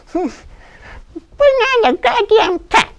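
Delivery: high, loud squeaky mouse bellowing; a tiny mouse roaring its drunken challenge; a tipsy mouse dares the cat, high and loud. Acted. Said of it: "you could hear him roar".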